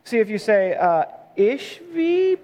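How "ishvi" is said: hesitant, drawn-out Ishvi, pitched up like a question; unsure. Shown as a demonstration. Pastor demonstrating a shaky reading of a Bible name, unsure. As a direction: say Ishvi slowly and high, doubting it. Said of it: "key to biblical pronunciation is confidence".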